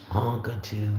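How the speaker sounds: ordinary talk, nothing marked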